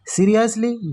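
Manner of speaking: a lightly voiced, high-pitched seriously; mock disbelief. Hajin scoffing at Asura's army boast, mock disbelief.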